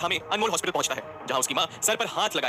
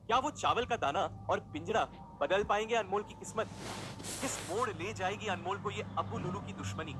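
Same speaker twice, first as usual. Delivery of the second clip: usual speaking voice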